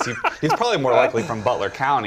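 tickled by your own joke; amused laughing. Real, not acted. Panelist ribbing a candidate's campaign photos, laughing.

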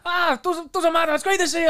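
high, loud brush-off from a thrilled mate; excited mate. Retold or imitated. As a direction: wave it off and hug him.

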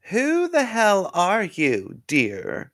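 high, slow sugary voice; sweet-sour old lady. A narrator's reading. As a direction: drawl it with fake sweetness. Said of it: "Wiener asked".

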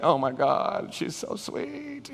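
soft, drawn-out lovestruck gush; mock swooning. Acted lovestruck teenager melting over crush.

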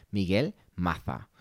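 slow "Maza" said "Matha" with a lisp; "Maza" with a Castilian th sound; Spanish pronunciation. Shown as a demonstration. For the Spaniards, mock Castilian "Maza". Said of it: "para los españoles".

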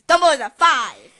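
loud emphatic declaration. Real, not acted. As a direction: shout the verdict out. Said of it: "and you'll see why Dumbledore's a five".

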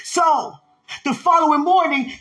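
usual speaking voice

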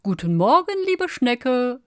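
high, loud greeting called out; cheerful call. A narrator's reading. Fox rushing past the snail, calling out good morning. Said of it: "rief er".

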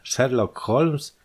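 slow, incredulous rising question; astonished echo. A narrator's reading. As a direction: repeat the name in disbelief, drawn out. Shocked echo of a feared name.

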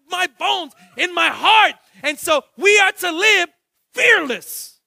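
loud, high preacher's call; full-force preaching. Acted. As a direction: preach it out to the whole church.

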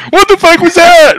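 put-on a kid stunned by the recoil; shocked outburst. Kid shocked by rifle recoil, high loud outburst.

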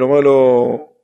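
usual speaking voice

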